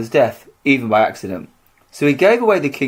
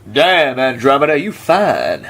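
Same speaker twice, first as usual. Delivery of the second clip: a hint of a smooth, flirty winged horse; cheesy pickup line, lightly voiced; flirty Pegasus voice; slower than usual. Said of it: "It's kind of".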